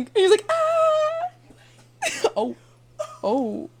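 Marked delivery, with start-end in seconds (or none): 0.5-1.3 high startled cry; startled yelp. Retold or imitated. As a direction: yelp like you got caught. Salesman startled reaction.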